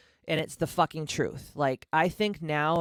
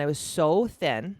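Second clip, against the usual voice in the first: usual speaking voice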